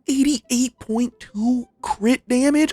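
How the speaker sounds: slow, high, incredulous reading of the number; stunned by the stat; amazed disbelief. Real, not acted. Streamer reading out crazy crit damage, slow amazed rise.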